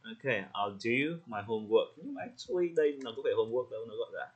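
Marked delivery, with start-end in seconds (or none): 0.5-1.9 slow line with weight on DO; short "I'll", then a clear DO; stress on DO. Shown as a demonstration. Teacher modelling the student's opening line, slowly stressing DO. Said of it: "mình sẽ nhấn vào do".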